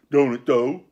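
loud, relieved exclamation; joyful cry. A narrator's reading. Dick relieved that the curse won't bind, joyful. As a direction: pounce on the good news. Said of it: "cried Dick with a sort of joy".

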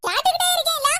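high, loud staged scolding; mock furious yell. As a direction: bark it like a tyrant mother-in-law. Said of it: "எல்லாம் நடிப்பு". (நடிப்புக் கோபத்தில் உரத்த அதட்டல்)

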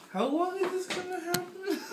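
laughing through the words; helpless giggling while talking. Real, not acted. Helpless laughter at failed eating.

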